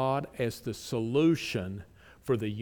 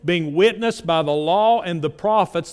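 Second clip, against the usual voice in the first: loud, raised preaching voice; forceful preaching; 4 semitones higher, 9 dB louder than usual. Acted. Preacher booming Romans to the congregation, loud and raised. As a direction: drive the verses home from the pulpit.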